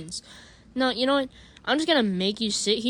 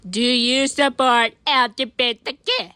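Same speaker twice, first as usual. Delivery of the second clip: louder, slowed-down question; slow loud repeat; 8 dB louder than usual. Acted. Asking Talking Ben again, slower and louder. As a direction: spell it out loudly so he hears.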